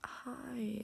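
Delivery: a breathy, high, tearful hi; imitating mom choking up on the phone; mom tearing up. Daughter imitates crying mom answering her call with a tearful whispered hi. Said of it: "And she started to cry".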